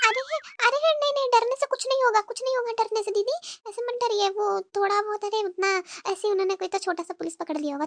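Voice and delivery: fading into a secretive murmur; hushed reassurance. Acted. Younger daughter-in-law secretly reassuring her sister-in-law near the police, fading to a whisper.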